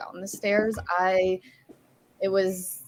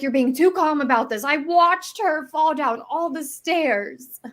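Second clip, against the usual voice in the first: high-pitched, mock-distressed protest; retold freaking out; 9 semitones higher, 3 dB louder than usual. Protesting that the calm parent missed the fall, imitated distress. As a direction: imitate someone upset, drawing out the last words. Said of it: "like, freaking out".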